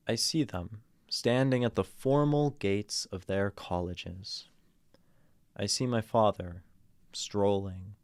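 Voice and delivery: measured read with long pauses; earnest poetry reading. Real, not acted. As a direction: read the poem sincerely, letting lines settle. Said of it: "give it an earnest read".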